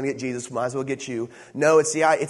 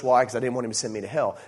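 ordinary talk, nothing marked

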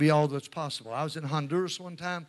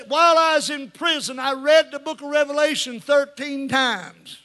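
high, loud retold testimony; excited confession; 11 semitones higher, 12 dB louder than usual. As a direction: blurt it out loudly, slowing at the end. Acting out the ex-convict's excited confession.

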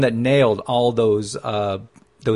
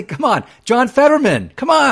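higher and louder, a rising cheer; playful hype shout-out; excited come on; 10 semitones higher, 6 dB louder than usual. Real, not acted. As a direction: egg him on with delight. Cheering a surprise ally.

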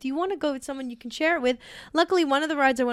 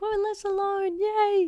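mock cheer; 10 semitones higher, slower than usual. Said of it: "I was like".

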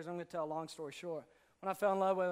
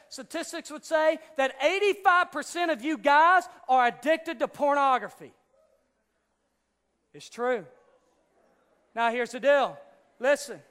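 raised, insistent preacher's voice; forceful preaching; 7 semitones higher, 11 dB louder than usual. Acted. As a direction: demand the crowd's attention.

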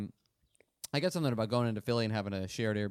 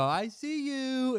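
high, drawn-out slow teasing; slow teasing grandma; 11 semitones higher, much slower than usual. Retold or imitated. Grandma at the bathroom door teasing, slow and high. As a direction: stretch it out like a playful grandma. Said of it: "she'd go".

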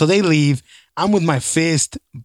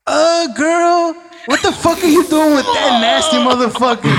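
put-on a higher, sassy girlfriend voice, half laughing; mocking the homegirl; 10 semitones higher than usual. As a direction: play the scandalized homegirl, pitched up. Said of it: "the homegirl's like".

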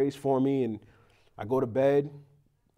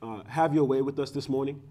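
prayer; 6 semitones lower, 4 dB quieter than usual. Real, not acted. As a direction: pray humbly and haltingly, asking forgiveness. Leads an opening prayer.

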